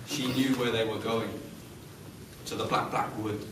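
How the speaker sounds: low, quiet, spooky; hushed storyteller dread; hushed ominous. A narrator's reading. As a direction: drop the voice for the dark wood.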